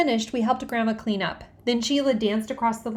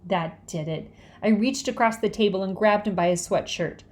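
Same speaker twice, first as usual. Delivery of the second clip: ordinary delivery, nothing marked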